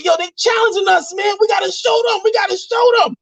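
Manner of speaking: high, hammed-up call to arms; mock rallying cry. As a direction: rouse the troops in a pretend kingdom. Rallying an imaginary kingdom.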